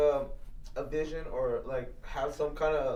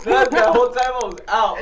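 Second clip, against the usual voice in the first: laughing; 5 semitones higher, 12 dB louder than usual. Real, not acted. Laughing retelling.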